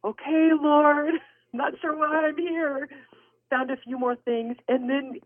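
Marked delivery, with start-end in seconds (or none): 0.0-2.9 high, drawn-out uncertain okay; wary compliance. Retold or imitated. Shopper to the Lord while creeping around Goodwill, wary. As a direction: tentatively humor a puzzling request, pitched high. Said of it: "kinda creeping around Goodwill, looking at the ceiling like".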